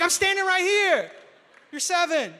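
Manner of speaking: put-on shouted high and loud at a sneaky kid; startled dad yelling, caught her red-handed; indignant father's outburst; exasperated shout.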